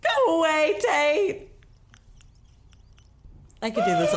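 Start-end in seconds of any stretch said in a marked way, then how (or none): 0.0-1.5 high, raised shooing call; playful shout at a friend; mock shout. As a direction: shoo him off in a playful yell.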